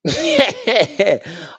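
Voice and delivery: sudden high laugh; burst of laughter. Real, not acted. Laughing at a player hit in the face. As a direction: laugh out loud at the replay. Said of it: "I don't know why I'm laughing".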